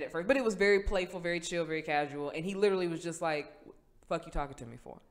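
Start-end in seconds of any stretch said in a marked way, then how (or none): 4.1-5.0 low, soft brush-off; dismissive shrug. Retold or imitated. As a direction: toss it away like a verbal shrug. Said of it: "First, it came with a shrug".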